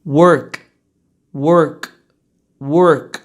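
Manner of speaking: "work" said low and loud, ending on k; crisp final consonant. Shown as a demonstration. Teacher close to the microphone, "work" ending clearly on k. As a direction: let the k be the last sound of "work". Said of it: "O último som que você escuta é o k".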